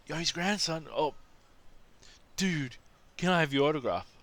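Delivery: high, starstruck fan voice; a fan meeting a celebrity's grandson; high, eager star-struck plea; starstruck tourist. Retold or imitated.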